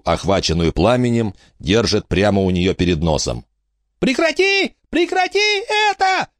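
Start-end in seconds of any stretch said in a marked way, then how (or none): 4.0-6.3 very high-pitched panicked squeal. A narrator's reading. Frankie squealing in Emma's grip, very high. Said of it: "визжала Фрэнки".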